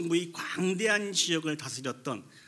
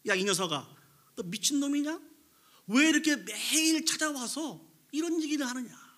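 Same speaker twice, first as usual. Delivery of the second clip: mock outburst, pitched high without full shouting; retold angry yell; 9 semitones higher than usual. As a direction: act out an exasperated mayor scolding a pest. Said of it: "이렇게 소리쳤다고 합니다".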